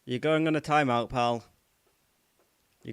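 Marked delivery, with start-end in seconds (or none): 0.0-1.4 loud stern ban announcement; loud telling-off. Real, not acted. Streamer giving a troll a timeout, loud stern telling-off. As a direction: bark the timeout at him.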